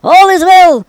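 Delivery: ringing herald's call. A narrator's reading. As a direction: call the news out to the king. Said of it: "And Ahimaaz called".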